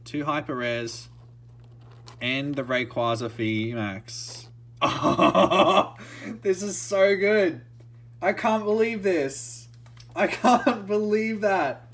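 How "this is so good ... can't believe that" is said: higher and louder, laughing through the words; real delight, laughing; giddy laughter. Real, not acted. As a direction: still can't believe the pull.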